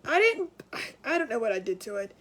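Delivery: ordinary talk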